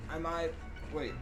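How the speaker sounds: ordinary talk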